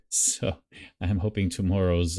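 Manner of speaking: usual speaking voice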